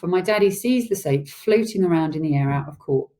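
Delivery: plain reading voice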